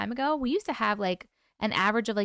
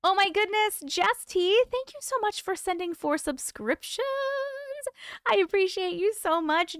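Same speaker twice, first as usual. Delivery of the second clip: excited thanks; 8 semitones higher than usual. Real, not acted.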